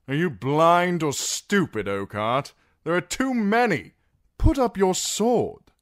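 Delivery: high, loud rebuke; rising exasperated shout; scornful shout. A narrator's reading. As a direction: yell at him to back down. Darkstar yells at the knight.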